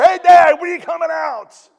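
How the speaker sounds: loud, high-pitched shout for Dad; kid yelling. Acted. Kids knocking on the bathroom door call Dad, shouting. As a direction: holler through a door like an impatient kid. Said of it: "The kids are knocking on the door".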